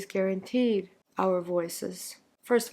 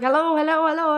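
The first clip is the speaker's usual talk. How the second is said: mother's repeated hello, higher and louder; mother calling hello; 8 semitones higher, 6 dB louder, faster than usual. Retold or imitated. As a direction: call out hello like an impatient mother on the phone. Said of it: "So she would be like".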